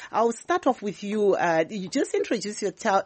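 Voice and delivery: normal talking voice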